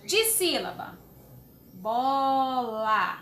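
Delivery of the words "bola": "Bola" stretched into two syllables. Shown as a demonstration. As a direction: draw out each syllable of "Bola". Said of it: "Abrir a boca duas vezes".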